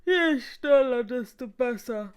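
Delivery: high, loud, drawn-out yawn. A narrator's reading. Tired guest to the receptionist, yawning loudly. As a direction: stretch the words through a big yawn. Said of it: "sagte Herr Schnarch und gähnte".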